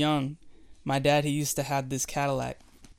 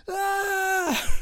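very high strained ah; boy straining to move the volume; straining kid cry; 5 dB louder than usual. Retold or imitated. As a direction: squeak like a child focusing hard.

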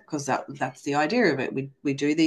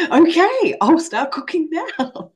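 much higher, loud, slowed cheerful okay; eager compliance; 10 semitones higher, 7 dB louder, slower than usual. Retold or imitated. She cheerfully obeys the big guy upstairs, high and loud. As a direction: sing out an eager yes sir. Obeying the voice from above.

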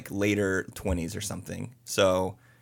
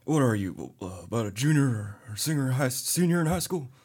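[name] voice. Pretend. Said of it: "And I did the [name] voice".

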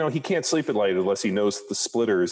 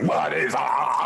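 slow, higher drawn-out what is up; grinding rumbling rock voice; 7 semitones higher, much slower than usual. Retold or imitated. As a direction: stretch the is, like Luke. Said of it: "you didn't do the way you got".

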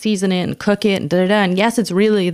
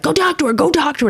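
high-pitched, eager mock urging; egging on; 9 semitones higher, faster than usual. Partner nudging comic toward her idol, egging on. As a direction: prod like a high-school kid pushing a friend. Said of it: "pushing me like a kid at high school".

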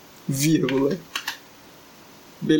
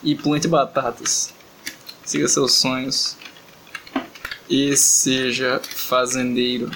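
drawn out, phrase by phrase with long gaps; slow dictation; 3 semitones lower, much slower than usual. Real, not acted. Composing a message for the translator, slow dictation. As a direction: dictate slowly while composing.

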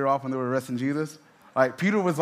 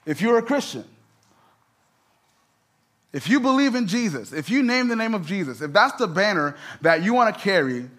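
full-force preaching; 2 semitones higher, 4 dB louder than usual. Acted. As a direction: preach it out to the congregation, rising.

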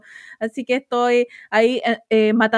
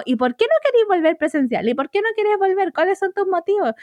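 higher, pushy mocking questions; nosy coworkers; 8 semitones higher than usual. Worker mocking colleagues who pester her about returning, higher and pushy. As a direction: mimic nagging colleagues, a bit higher. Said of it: "empiezan así como".

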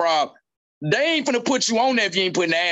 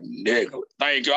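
understated thanks; mock grateful friend; 6 semitones lower than usual. Kevin's grateful look voiced.

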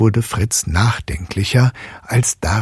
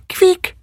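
high squeak; 4 dB louder than usual. A narrator's reading. Mouse squeak in story.

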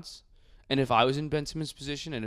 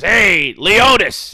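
much higher, louder, barking like a hoarse coach; Alex Jones-style coach; 8 semitones higher, 15 dB louder, much slower than usual. Retold or imitated. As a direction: bellow it like a gruff football coach. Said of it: "He goes, ... talked like Alex Jones".

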